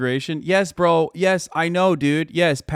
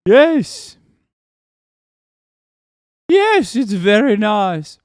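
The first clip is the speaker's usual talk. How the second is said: high, loud caricature of a politician; drawn-out, hammed-up posh politician impression; Widdicombe; 7 semitones higher, 6 dB louder, much slower than usual. Streamer doing a requested accent, mock Widdicombe impression. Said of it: "And Widdicombe accent now".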